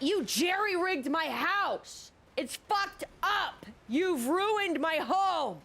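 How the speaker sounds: very high, slow mock screech; imitating screaming customer. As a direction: wail the accusation like an enraged customer. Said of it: "screaming at the top of her lungs".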